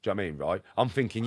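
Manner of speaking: ordinary talk, nothing marked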